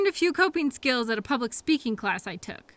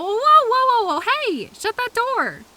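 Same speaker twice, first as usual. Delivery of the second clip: high, loud alarmed whoas; yelling; 9 semitones higher, 7 dB louder than usual. Acted. Driver yelling as coworker opens the door. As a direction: shout to stop them leaving. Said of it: "I yelled at you again".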